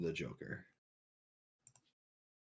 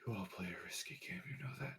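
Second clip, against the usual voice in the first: hushed, threatening whisper to the party; menacing whisper; 16 dB quieter, much faster than usual. Acted. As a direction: breathe the warning out without voice. Villain taunts party after revival.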